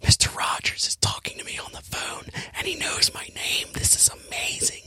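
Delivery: thrilled whisper, high and breathless; excited whisper. Retold or imitated. Wife thrilled that Mister Rogers called, whispering. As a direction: whisper it like you can't believe it. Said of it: "gives him this look like".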